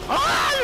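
high, sharp screams of fright; real terrified high screaming; panicked screams. Real, not acted. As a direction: scream as it lunges. Monster attacking.

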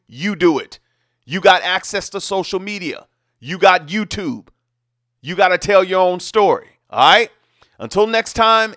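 measured anchor delivery, low and even; reporter voice. Acted. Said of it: "Let me get back to my reporter voice".

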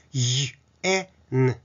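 ゆ、え、ん in separate pieces, Y held tight; sound by sound. Shown as a demonstration. Teacher building the word yen, segmented sounds. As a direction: sound out ゆ、え、ん one at a time. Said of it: "連続すると".

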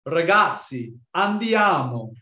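"Ragazzi, andiamo" in full, clear Italian vowels; standard Italian. Shown as a demonstration. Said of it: "so in a standard Italian, it'd be like".

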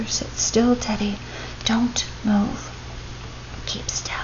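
frightened fading whisper. A narrator's reading. Father whispers to Teddy beside the snake, soft whisper. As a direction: breathe it out softly to the boy. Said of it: "all his father could do was whisper".

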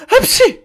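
high, loud sneeze. Acted. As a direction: burst out in a squeaky sneeze. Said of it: "o niye hapşırıp duruyor". (hapşırma)

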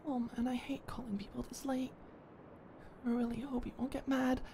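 soft unvoiced worry, half to self; worried whisper. Dreading a late call to the landlord, worried whisper.

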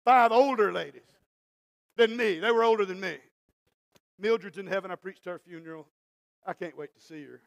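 usual speaking voice

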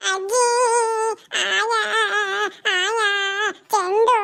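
high, drawn-out wailing words; crying child. Acted. Hurt child complains to grandmother about the ball, crying. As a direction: wail each word like a hurt child. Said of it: "ಅಳ್ಬಾರ್ದಮ್ಮ".

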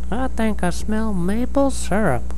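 high-pitched little mole voice; Papa Mole. Acted. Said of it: "turned to Mama Mole and said".

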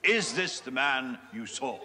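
ordinary talk, nothing marked

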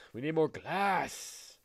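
drawn-out game call. Real, not acted. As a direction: announce it like a rallying cry. Calling out in game.

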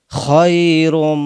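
slow খায়রুম with rough kh; correct kh. Shown as a demonstration. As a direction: scrape the খ in খায়রুম from the throat. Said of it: "খ উচ্চারণ করতে হবে".

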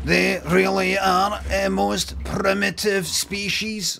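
lightly voiced robotic alien, slow; slow robot Martian. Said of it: "Smash adverts".

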